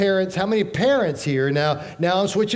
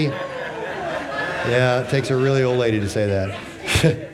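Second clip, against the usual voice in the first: laughing; 3 semitones lower, slower than usual. Real, not acted. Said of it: "Who said that?".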